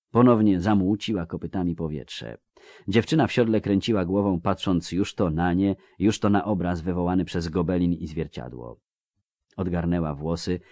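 ordinary delivery, nothing marked

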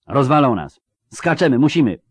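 loud, high, pressing plea; urgent shout. A narrator's reading. Geralt warning they will be killed, shouting urgently. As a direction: push them hard to jump. Witcher urging escape under fire.

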